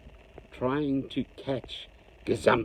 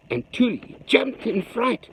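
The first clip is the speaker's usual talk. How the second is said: usual speaking voice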